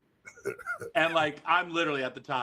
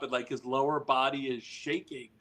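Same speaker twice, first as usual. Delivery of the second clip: ordinary delivery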